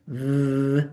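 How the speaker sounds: v with buzz; voiced v. Shown as a demonstration. Teacher turning f into v. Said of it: "Say f with your vibration".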